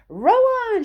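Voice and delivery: loud high call to the rowers; shout. A narrator's reading. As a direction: bellow it like a captain. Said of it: "shouted Mister Wonka".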